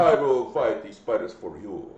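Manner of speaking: put-on low Spanish-accented hero; mock Spanish accent. Joking to co-hosts about fighting spiders, in a low mock Spanish accent. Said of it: "I don't know why I gave that accent".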